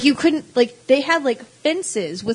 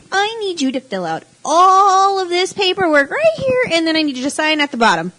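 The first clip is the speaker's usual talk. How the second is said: high voice; 7 semitones higher, 3 dB louder than usual. Retold or imitated. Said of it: "what kind of voice someone's gonna have".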